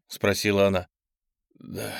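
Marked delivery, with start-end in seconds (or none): 1.6-1.9 weak, very soft, low reply; faint, strained murmur; forced out with effort. A narrator's reading. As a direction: barely squeeze the word out. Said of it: "с трудом выдавил я".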